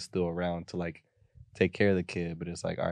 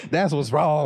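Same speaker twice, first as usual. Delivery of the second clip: high mock uncle; 7 semitones higher, 5 dB louder, slower than usual. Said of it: "continuing the uncle bit".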